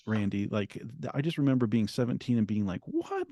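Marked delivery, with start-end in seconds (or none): 2.9-3.3 very high-pitched, incredulous yelp of a question; baffled disbelief. Retold or imitated. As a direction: replay a bewildered teenager's disbelief, pitch shooting up. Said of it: "I didn't understand it".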